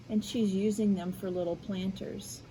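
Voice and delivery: usual speaking voice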